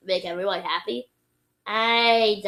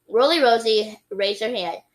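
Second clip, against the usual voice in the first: ordinary delivery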